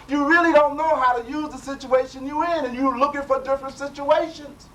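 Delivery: high and loud, urging the congregation; fervent preacher exhorting; preaching at full force. Real, not acted. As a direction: drive the point home, fervent.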